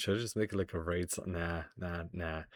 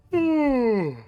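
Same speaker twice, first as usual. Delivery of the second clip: loud, high, drawn-out yawn into the mic; big fake yawn; 6 dB louder than usual. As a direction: yawn as loudly as you can. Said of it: "is that enough yawning".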